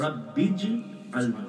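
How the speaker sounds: رب اجعلنی very slow, ba joined to jim; careful model of رب اجعلنی; correct Arabic pronunciation. Shown as a demonstration. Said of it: "یہ صحیح تلفظ ہے". (رب اجعلنی صحیح تلفظ، ٹھہر کر)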